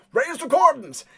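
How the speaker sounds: high, loud battlefield call; yelled coordination. Acted. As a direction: yell an order across the field. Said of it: "he hears yelled coordinations".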